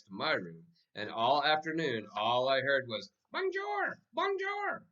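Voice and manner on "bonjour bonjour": very high squawking bird voice; mynah bird. A narrator's reading. The mynah bird greeting everyone in French, high bird squawk. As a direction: squawk it like a parrot. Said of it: "in Bird's voice".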